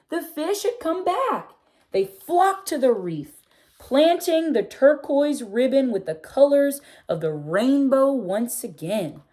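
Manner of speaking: put-on gentle read-aloud for children, telling the tale; storybook reading. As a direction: read it like a bedtime picture book. Reading picture book aloud.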